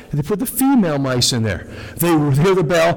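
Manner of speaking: normal talking voice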